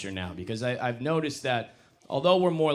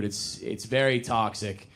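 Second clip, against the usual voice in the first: ordinary talk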